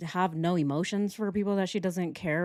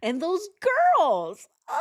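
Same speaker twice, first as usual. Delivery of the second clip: fake crying; 9 semitones higher, 4 dB louder than usual. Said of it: "she was actually really crying about herself".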